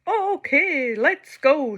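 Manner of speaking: loud, high call to go; announcing. Acted. Sheila announcing the launch, loud and high. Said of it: "Sheila announced".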